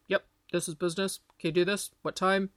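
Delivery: quick, clipped-to-the-point talk; curt businesslike guy. Retold or imitated. Host imitating how men talk business on the phone, mock curt. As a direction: rattle it off, no small talk. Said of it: "the guys communicate differently".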